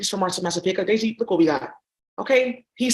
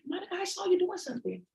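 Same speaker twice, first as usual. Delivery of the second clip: much higher, much softer mother's voice; mom voice; 9 semitones higher, 9 dB quieter than usual. Retold or imitated. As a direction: coo like a gentle mom. Mom voicing again.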